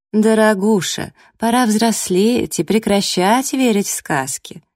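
high, slow patronising drawl; mock-sweet condescension; condescending. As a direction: talk down to her as if to a child. Rival patronises the naive heroine.

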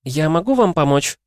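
high sing-song receptionist. A narrator's reading. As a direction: chirp it sweetly and brightly. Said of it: "пропела она".

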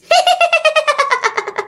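very high, stuttering spooky cackle; eerie ghostly laugh. Acted. Dead woman laughing at a drunk neighbour, eerie high cackle. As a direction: let out a high, creepy ghost giggle. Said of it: "nghe cái tiếng bà cười nghe ghê lắm".

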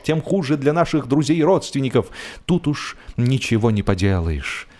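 plain reading voice

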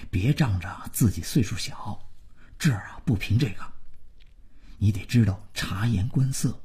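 quiet, quick fatherly warning; hushed admonishing. A narrator's reading. Father briefing son on pleasing the household, hushed admonishing. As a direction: drum the house rules in under your voice. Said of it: "他叮嘱儿子".

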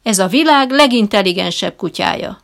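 loud proud showman's claim; booming boast. A narrator's reading. Trainer boasting about his dog, loud. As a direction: boast it to the whole room. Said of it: "folytatja harsányan".

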